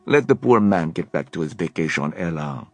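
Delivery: put-on slow, amused French accent; teasing French accent. A narrator's reading. Leon teasing Helen about Alec, French accent. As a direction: admire him playfully. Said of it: "a French accent".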